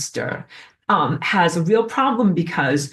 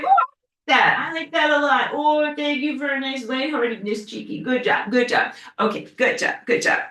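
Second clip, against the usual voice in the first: much higher, lighter playful voice; voicing Cheeky; 7 semitones higher than usual. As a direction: speak for Cheeky in a high, playful voice. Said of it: "and she's like".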